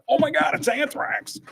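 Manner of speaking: very high-pitched retold fan squeal; starstruck excitement. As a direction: gush like a fan spotting the band. Retold fans spotting their band.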